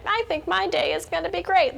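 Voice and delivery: high, sing-song parody of a gushing post; mock chirpy poster. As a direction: put on a high, self-satisfied voice. Mocking a vapid social media post.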